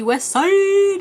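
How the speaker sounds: high, loud, drawn-out shout-out; West Side call. Acted. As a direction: stretch the last word into a playful holler.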